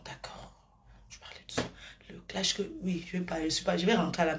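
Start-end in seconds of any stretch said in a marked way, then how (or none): 0.0-2.2 soft unvoiced whisper. Real, not acted. Agreeing to speak softly, she whispers. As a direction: drop to a whisper on the spot.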